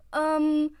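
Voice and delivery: loud, high muffled call; as if from inside a locker. Acted. Teammate hidden in a locker, muffled interruption. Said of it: "a muffled voice".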